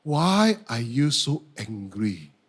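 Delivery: low, slow, measured question; unhurried deep divine voice; God's questioning voice. Retold or imitated. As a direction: ask it with calm, patient authority. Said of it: "God asked me a very important question".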